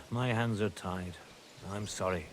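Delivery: soft and low, fading apology; regretful. Acted. Father turning the squire down, quiet and regretful. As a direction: let it sink away in regret.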